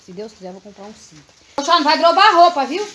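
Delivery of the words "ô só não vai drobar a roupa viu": bossy order. Real, not acted. Ordering son to fold clothes.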